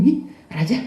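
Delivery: high, bewildered exclamation; a servant voicing confusion to his king; puzzled bodyguard. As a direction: sound baffled by the king's behaviour. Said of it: "Pengawalnya sampai bingung".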